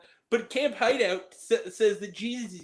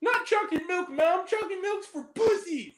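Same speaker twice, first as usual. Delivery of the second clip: put-on bratty kid; 10 semitones higher than usual. Kid yelling to mom.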